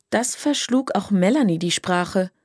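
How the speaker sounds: usual speaking voice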